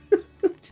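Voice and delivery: high cracking laugh; burst of laughter. Real, not acted. Laughing at bad line.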